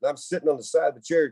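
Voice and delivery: ordinary talk, nothing marked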